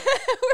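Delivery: loud squealing laugh; high burst of laughter. Real, not acted. Laughs after oopsie.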